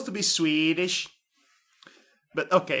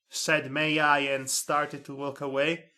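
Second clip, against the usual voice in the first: ordinary delivery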